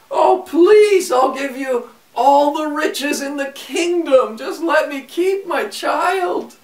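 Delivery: high, loud begging cry; a frantic mother, pitched high and loud; desperate pleading. A narrator's reading. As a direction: plead for your child's life. Queen begs for her child.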